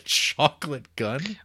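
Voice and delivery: ordinary talk, nothing marked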